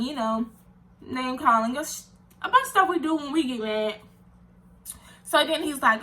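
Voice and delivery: ordinary delivery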